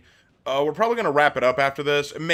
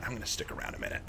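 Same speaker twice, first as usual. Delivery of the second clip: a soft secretive whisper; hushed whisper, much softer; whispered aside; 12 dB quieter, faster than usual. Acted. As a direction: whisper it under your breath. Repeats line, second whispered.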